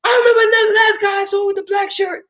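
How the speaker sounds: high shouted accusing voice; mock accusing shout. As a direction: shriek the paranoid accusation. Trauma voice accusing someone.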